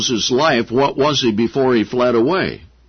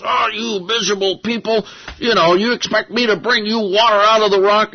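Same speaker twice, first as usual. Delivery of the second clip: higher, fed-up Moses scolding; exasperated Moses; 9 semitones higher than usual. Retold or imitated. Moses scolding the people who want water, exasperated. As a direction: throw the complaint back at them. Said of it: "he was so angry".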